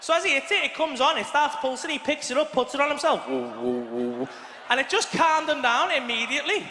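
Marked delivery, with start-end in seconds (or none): 3.3-4.3 low, loud drawn-out whoa; mock awed boy. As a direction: do the boy mesmerised by the buzzing toy. Imitating son again.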